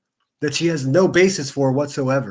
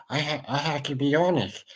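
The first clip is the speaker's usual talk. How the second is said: mocking sleepy voice; 4 dB quieter than usual. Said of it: "old droopy motherfucker gonna wake up and say".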